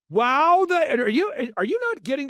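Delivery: high-pitched theatrical imitation; Michael Levine. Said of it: "he, this is how he talks".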